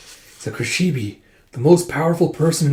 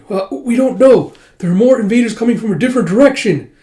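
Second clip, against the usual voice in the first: panicked report; 9 semitones higher, 7 dB louder than usual. Acted. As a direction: blurt out bad news in panic.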